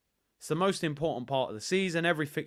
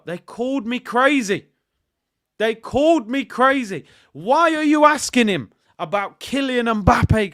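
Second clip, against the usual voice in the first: exasperated; 7 semitones higher, 9 dB louder than usual. Real, not acted. Ranting about being mocked.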